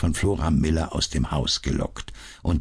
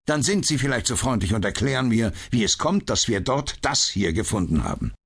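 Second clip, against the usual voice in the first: a touch higher and quicker, defensive; lightly voiced irritated inspector; 6 semitones higher than usual. A narrator's reading. Lestrade defending his case to Holmes, lightly irritated. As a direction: Scotland Yard man pushing back, a hint of irritation.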